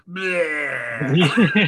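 guttural Butcher grunt; menacing butcher. Retold or imitated. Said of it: "the Butcher just going".

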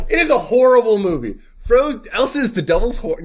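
high, fast mock-furious rant. Real, not acted. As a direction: rant about the movie in mock fury. Ranting about the movie Frozen.

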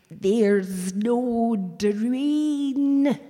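very slow, dragged-out slurred words; groggy slow slur; mumbled through oxygen mask. Retold or imitated. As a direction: fight to get the words out past a mask, drowsy on morphine. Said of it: "I'm completely incoherent, I'm just mumbling".

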